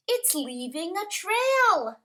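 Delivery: high-pitched, eager discovery; excited children. A narrator's reading. Children notice the snail's silvery trail, excited and high. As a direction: lift it high with wonder. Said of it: "say the children".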